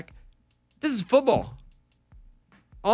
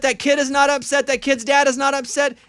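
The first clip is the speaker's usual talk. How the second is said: heated rant; 12 semitones higher, 7 dB louder than usual. Real, not acted. Ranting about viewers.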